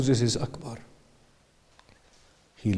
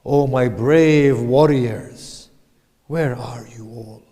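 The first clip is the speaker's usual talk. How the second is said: loud, drawn-out battlefield call to lost warriors; crying out; 10 dB louder than usual. A narrator's reading. Hussein calls to his fallen warriors, crying out loud. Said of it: "and he cries out".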